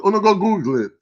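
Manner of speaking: normal talking voice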